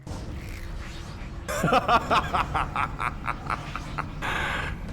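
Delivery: menacing laugh. Acted. Illidan greets the arriving jailer.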